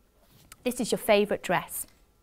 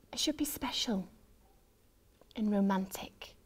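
whispering; 8 dB quieter than usual. A narrator's reading. Disappointed hushed lines.

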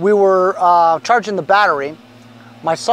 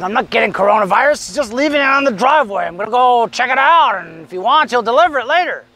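lightly voiced husband pleading his case; protesting husband; 4 semitones higher than usual. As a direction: argue back, a bit higher, like an overruled husband. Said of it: "He's like".

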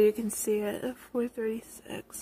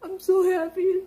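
high, louder, crying through the words; real happy crying, barely holding it together; tearful disbelief; 6 semitones higher, 5 dB louder than usual. Real, not acted. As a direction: sob out the disbelief with joy. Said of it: "just give me a minute".

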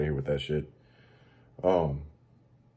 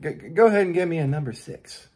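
mock customer caving; 9 semitones higher, 4 dB louder than usual.

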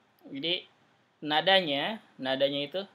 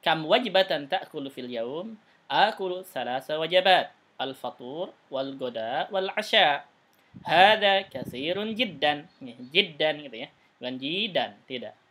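louder, slow teacher reading with clear question and answer tunes; a touch slower and louder, each line shaped to its tune; model reading; 5 dB louder, slower than usual. Shown as a demonstration. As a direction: read the dialogue aloud as a model for the class. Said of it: "sesuaikan dengan nadanya".

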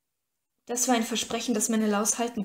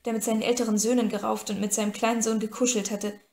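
plain reading voice

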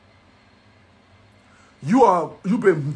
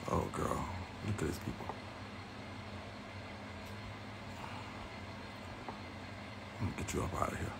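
whispered aside to herself; conspiratorial whisper about the viewers; 23 dB quieter than usual. Real, not acted. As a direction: breathe it out under the breath, no voice.

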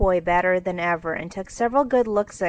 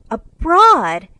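loud, high burst of amazement; excited cry; 9 semitones higher, 11 dB louder than usual. A narrator's reading. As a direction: cry it out, pitch leaping up. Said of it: "cried Jo".

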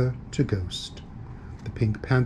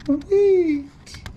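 high, loud, panicky cry; frantic calling; 4 dB louder than usual. Acted. Ghost chasing his stolen sheet, frantic calling. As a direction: call after them, building to a wail. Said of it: "the ghost called".

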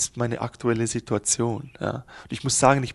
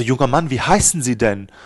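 stern policeman; 8 dB louder than usual. Acted. Policeman questions the driver.

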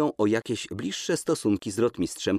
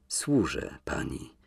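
low, slow and softer, a touch hoarse; 7 semitones lower, 5 dB quieter, slower than usual. Acted. Embarrassed man handing her the robe, hushed and hoarse. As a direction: force it out through a tight throat. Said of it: "powiedział głosem ochrypniętym".

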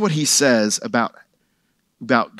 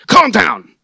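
"Calm down" barked loud and higher, an impatient parent; annoyed edict; 6 semitones higher, 10 dB louder than usual. Retold or imitated. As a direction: snap "Calm down" at a frantic child. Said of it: "That's sounding more like an edict".

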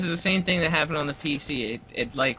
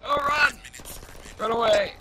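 panicked yelling; 5 semitones higher than usual. Real, not acted. Fleeing enemies.

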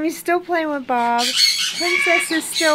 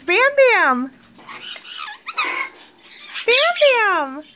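calling out; 11 semitones higher, slower than usual. Real, not acted. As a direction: call the parrot's name across the room. Calling to her parrot.

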